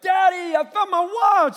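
put-on very high, loud child's shout; excited little girl. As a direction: burst out like a thrilled kid waking Dad. Daughter wakes father with news.

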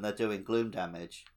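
normal talking voice

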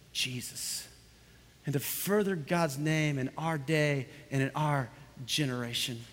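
soft, measured phrases with pauses; hushed preaching. Real, not acted. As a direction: drop to a solemn, quiet close, slowing as you go. Hushed close after preaching climax.

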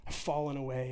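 the stumbling believer thinking aloud, soft and defeated; defeated thought. Acted. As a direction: give up on yourself, quietly. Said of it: "we think".

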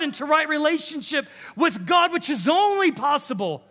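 rising preacher's shout of freedom; full force preaching. Acted. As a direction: preach it out with fire.

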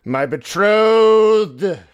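long, loud, mournful wail; dramatic slow cry. Acted. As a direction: stretch it out like a grieving lover. Vampire's dramatic outburst.

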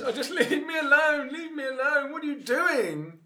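high, loud drawn-out wail; hammed-up cry to be left alone; pleading protest. Cornered woman to her questioners, high wailing protest.

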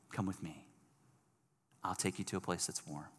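hushed and tender, with unhurried pauses; gentle invitation. Acted. Rescuer inviting a freezing person somewhere warm, gentle invitation.

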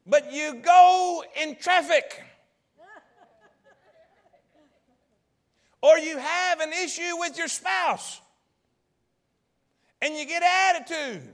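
loud, driving preacher cadence; full-force preaching. Acted. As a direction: preach it out to the whole room. Preaching to the congregation.

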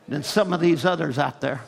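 ordinary talk

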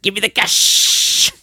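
strained, high-pitched imitation of a frantic mugger; mock robber's demand. Host retells a favorite doorstep mugging scene, mock frantic demand. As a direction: drag out the last word in a hammed-up rasping cry. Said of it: "and he's just like".

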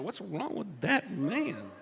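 slow, swooping, puzzled drawl; mock bewilderment. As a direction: imitate baffled onlookers, dragging each word. Mimics onlookers staring at singing driver.

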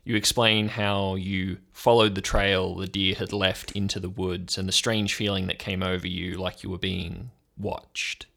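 ordinary talk

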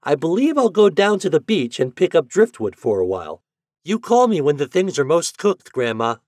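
put-on lively boy calling to Grandma; excited boy. A narrator's reading. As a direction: announce your plan with boyish energy.

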